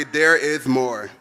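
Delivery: slow call to repeat. Shown as a demonstration. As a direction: spell it out slowly for the crowd to echo.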